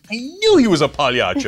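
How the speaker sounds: laughing mock uncle. Imagined great uncle laughing that his nephew is a clown. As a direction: laugh through the uncle's told-you-so.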